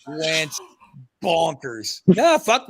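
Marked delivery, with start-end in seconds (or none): none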